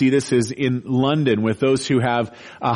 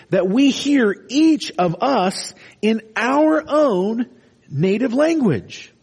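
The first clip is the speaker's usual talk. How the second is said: high, slow, mocking disbelief at the Galileans; incredulous crowd; 8 semitones higher, slower than usual. Bewildered Jerusalem crowd scoffing at the Galileans, high and slow. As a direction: scoff at fishermen speaking languages.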